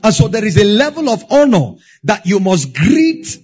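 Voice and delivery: emphatic preaching. Real, not acted. Pastor urging believers to stay attached to that voice, emphatic preaching. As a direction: press the charge firmly on the congregation. Preacher builds to firm exhortation.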